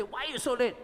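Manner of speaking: a sharp wife's demand; yelling wife. Retold or imitated. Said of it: "your wife yell at you and say".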